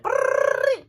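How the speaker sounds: drawn-out trilled brrrr; imitated whirr. Ducky shooting through the sewer, long trilled whirr. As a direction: roll out a long brrr like something whizzing through.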